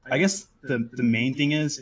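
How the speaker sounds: ordinary talk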